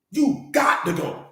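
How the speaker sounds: measured, slow finality; slow and deliberate. Acted. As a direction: drag out You got to go slowly. Repeats the line for emphasis.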